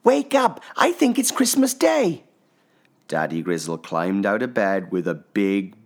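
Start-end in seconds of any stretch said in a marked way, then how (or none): 0.0-2.2 put-on child voice. A narrator's reading. Little Pip, eager cub.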